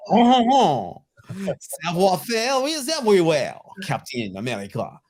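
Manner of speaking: hammed-up French accent, high and loud at the start; a silly bad French accent for a Marvel character. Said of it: "is my bad French accent".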